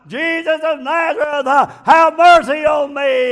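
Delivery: loud, high, desperate shout for mercy; screaming plea. Retold or imitated. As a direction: cry out to Jesus at the top of the lungs. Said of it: "the louder he screamed".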